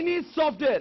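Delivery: high, loud call-out; emphatic dictation. Shown as a demonstration. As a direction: announce the key term to the class. Calling out definition in English.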